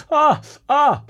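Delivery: short high "ah" noises, imitating a schoolboy; two high-pitched mock cries; imitated sound effect. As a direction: mimic a pupil's silly high yelps. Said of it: "Dylan was going".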